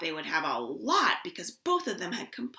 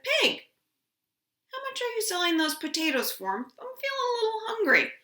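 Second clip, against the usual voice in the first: a higher, eager bear voice asking to buy; hungry bear, a bit higher; lightly voiced Bear; 10 semitones higher, faster than usual. Bear asks Pig the price of potatoes, higher and eager.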